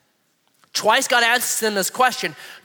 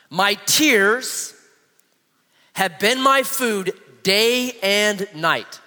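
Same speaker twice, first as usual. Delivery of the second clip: slow, drawn-out psalm reading; scripture reading; slower than usual. Acted. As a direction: read the psalm slowly and reverently. Reads psalm aloud slowly.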